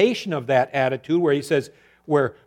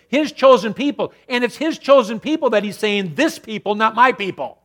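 loud, higher preaching at full force; fervent preaching; 6 semitones higher, 8 dB louder than usual. Acted. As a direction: preach with rising fervor.

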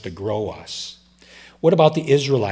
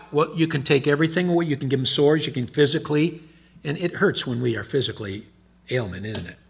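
ordinary delivery

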